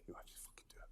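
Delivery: hushed, breathy aside; half-whispered blunt order; retold whisper. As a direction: drop it to a conspiratorial whisper. Boss's blunt verdict on the plan.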